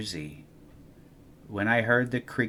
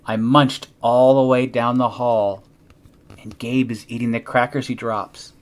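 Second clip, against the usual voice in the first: ordinary delivery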